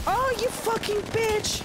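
slow, high, loud curse; furious yell. Real, not acted. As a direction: drag out the insult in a high shriek. Cursing enemy during fight.

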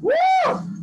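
high, bright cheer; excited whoop. Real, not acted. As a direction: whoop to pump the class. Instructor cheering mid-round.

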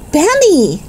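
big sister catching him in mischief; shocked cry. A narrator's reading. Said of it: "she cried".

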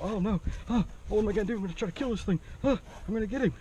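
hammed-up high panicky babble; mock freaking out. Acting out someone who panics and wants to kill the snake, mock high panic. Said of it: "you know, freaking out".

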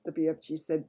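plain reading voice

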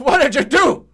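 loud, high outburst; loud play-acted accusation; alarmed shout. Mock alarm at a mishap.

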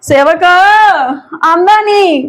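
loud high call for the younger brother. Acted. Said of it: "ਉਹ ਭਾਬੀ ਹੌਲੀ ਬੋਲੋ".

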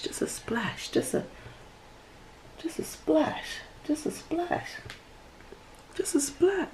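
high hushed whisper; light whispered repeat. Real, not acted. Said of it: "But I'm whispering".

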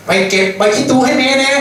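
put-on a mother asking her boy for basil leaves; mother calling. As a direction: call the boy like a mother sending him out.

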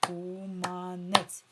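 КУМАНЕЦЬ loud, in three slow beats KU-MA-NETS; syllable by syllable. Shown as a demonstration. Teacher has pupils count syllables, syllable by syllable. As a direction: chant КУМАНЕЦЬ evenly, one clap per syllable. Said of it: "Три рази я хлопнула".

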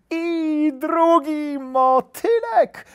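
high, loud, slow gleeful shout; squealing, stretched-out delight; overjoyed little hare. A narrator's reading. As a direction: call out with childish joy. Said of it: "zawołał uradowany zajączek".

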